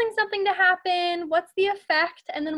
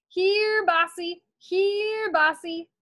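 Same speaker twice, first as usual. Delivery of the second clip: higher sing-song cow call; 4 semitones higher, much slower than usual. Acted. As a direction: call the cows into the barn. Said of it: "the Scouts called".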